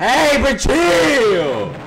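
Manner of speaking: loud dragged-out protest; drawn-out shout. Real, not acted. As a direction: stretch it out and shout. Reacting to girl saying ew.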